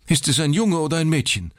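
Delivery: high, urgent question in a changed voice; nervous father pressing; anxious question. Acted. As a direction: plead for the answer.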